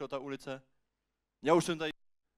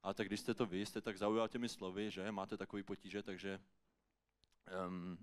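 low, soft, halting reply; hesitant giving-in; 5 semitones lower, 5 dB quieter than usual. Acted. Passer-by reluctantly answers the beggar, hesitant and subdued. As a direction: give in awkwardly, trailing into a hesitation.

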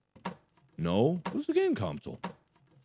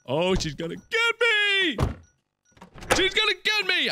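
high, panicked wail; 13 semitones higher than usual. Real, not acted. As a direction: cry it out in real panic. Panicking about the lady.

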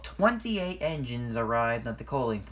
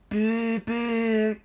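loud, high engine horn; two high loud toots; imitated horn blast; 13 semitones higher, 7 dB louder than usual. A narrator's reading. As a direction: blare it like a train horn.

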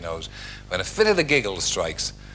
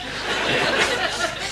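high giggle. Real, not acted. Narrator's laugh sound.

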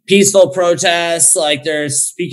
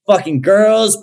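ordinary talk

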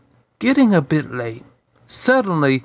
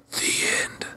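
breathy whispered close; whispered ending; 11 dB quieter than usual. Real, not acted. Narrator closing the story, soft whisper. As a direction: whisper the final words. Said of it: "story closing".

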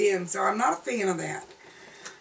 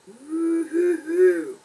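mock whoop; 9 semitones higher, 7 dB louder, much slower than usual. Mocking pink strobe lips.

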